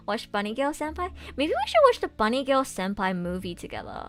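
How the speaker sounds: ordinary talk, nothing marked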